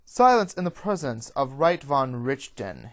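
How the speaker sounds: loud, stern command; stoic guard. A narrator's reading. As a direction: order silence with stoic authority. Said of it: "he says in a stoic tone".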